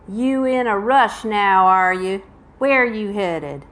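loud Southern drawl; amateur Southern accent, challenging a traveler. Acted. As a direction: play a prying Southern stranger. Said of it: "you will hear my Southern accent".

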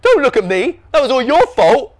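high, loud accusation; indignant blaming. A narrator's reading. Norm blaming his brothers, indignant. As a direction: throw the blame back hotly.

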